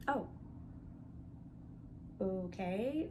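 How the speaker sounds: ordinary delivery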